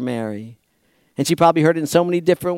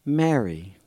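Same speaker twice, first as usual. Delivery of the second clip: tender name; 4 semitones lower, 6 dB quieter than usual. Acted. Jesus speaking her name tenderly.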